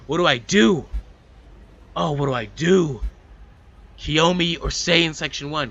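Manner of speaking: agonising over a choice she can't make; frustrated outcry. Real, not acted.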